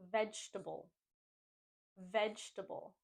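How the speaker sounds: vegetable said VEJ-tuh-bull; "vegetable" with no vowel after VEJ; three-syllable vegetable. Shown as a demonstration. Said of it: "but we just wanna say".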